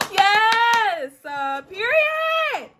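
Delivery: high, loud, drawn-out cheers; excited squealing. Real, not acted. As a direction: squeal it out, thrilled by the delivery. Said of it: "I'm so excited right now".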